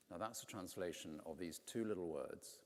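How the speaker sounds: usual speaking voice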